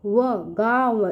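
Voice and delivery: व, गाँव said slowly, sound by sound; teacher sounding out गाँव for kids; slow spelled-out demo. Shown as a demonstration. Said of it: "ग पे आ की मात्रा गा".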